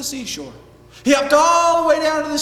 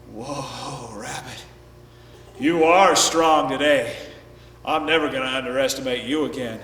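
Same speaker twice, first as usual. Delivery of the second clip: low, slow, awed elephant voice; big animal in dazed respect; humbled elephant; 11 semitones lower than usual. Acted. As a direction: admit defeat deep and weary. Beaten elephant praises rabbit.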